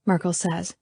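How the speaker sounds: ordinary delivery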